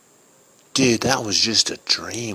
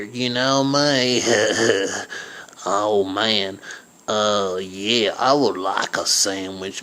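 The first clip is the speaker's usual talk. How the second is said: lazy neighbor. Acted. Neighbor Billy Bob voiced.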